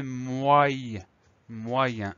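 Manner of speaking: MOY- drawn out slowly, then MOYEN said whole; pronunciation demo. Shown as a demonstration. Teacher showing how moyen is pronounced, MOY- held long before MOYEN. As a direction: stretch the glide in MOY-, then complete MOYEN. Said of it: "ça va se prononcer".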